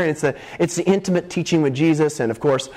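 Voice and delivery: normal talking voice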